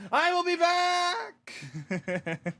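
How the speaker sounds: high, very drawn-out cry; slow, high cartoon wail; parting vow. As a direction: holler it while being carried off. Departing character vows return.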